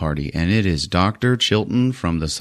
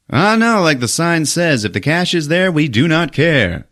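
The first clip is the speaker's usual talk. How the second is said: mock cocky captain; 9 semitones higher than usual. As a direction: toss it off like a cocky hired gun. Captain voiced.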